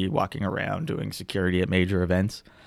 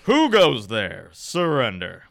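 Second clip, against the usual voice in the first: slow, loud challenge; drawn-out, booming order; guard's challenge; 6 dB louder, slower than usual. Acted. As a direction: call out a commanding sentry's halt. Game master voices approaching guard.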